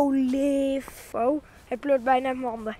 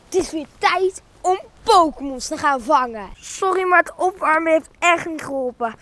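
ordinary delivery

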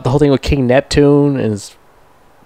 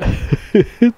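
short laugh; 11 semitones higher than usual. Real, not acted. Laughs again at the scene.

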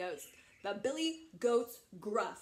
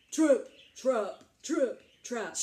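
loud, weighty stomps; heavy big footsteps; 9 dB louder, slower than usual. Acted. Very big billy goat crossing the bridge, loud and heavy. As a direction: land each word like a huge hoof. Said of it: "Remember, he's very big".

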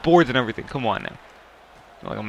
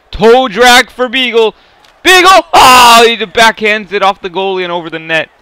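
excited play-by-play; 8 semitones higher, 10 dB louder than usual. Real, not acted. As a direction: call the move like a thrilling near goal. Near goal in hockey game.

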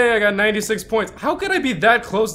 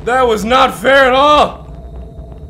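slow, louder protest leaning on "fair"; indignant complaint; 4 semitones higher, 7 dB louder, much slower than usual. Real, not acted. Streamer protesting the ghost's ambush, slow loud complaint. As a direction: drag out the unfairness.

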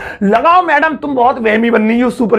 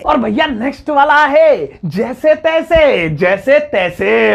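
slow, higher announcer voice; announcing the next word; 4 semitones higher, slower than usual. Shown as a demonstration. Teacher announcing the next word to students, slow and higher. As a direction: present the next word like a show host. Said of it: "announces next word".

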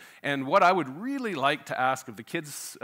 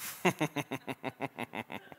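chuckle; 9 dB quieter than usual. Real, not acted. Preacher after his hockey joke, soft chuckle. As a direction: chuckle quietly at your own joke.

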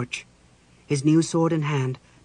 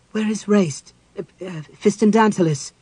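high, loud, flustered stammer; 6 semitones higher, 7 dB louder than usual. A narrator's reading. Chrysania asks the spectre after Raistlin, stammering. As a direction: stumble over the name in agitation. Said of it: "Chrysania stammered".

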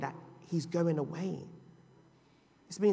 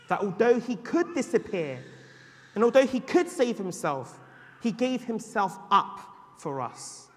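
rising louder then slowing to land it; preaching build; 4 semitones higher, 6 dB louder than usual. Acted. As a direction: build the appeal, then settle. Preaching at full force.

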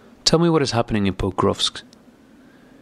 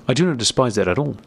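usual speaking voice

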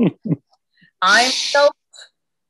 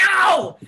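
sudden loud yelp, higher; loud pained yelp; 6 semitones higher, 6 dB louder than usual. Acted. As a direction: cry out as you slip and fall. Fell and hurt himself.

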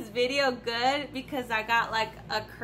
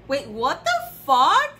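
shocked; 3 semitones higher, 8 dB louder, slower than usual. Real, not acted. Shocked at the perfume box.

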